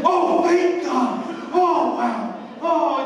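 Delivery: huge relief. Retold or imitated. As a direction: exhale thank God like a weight just lifted.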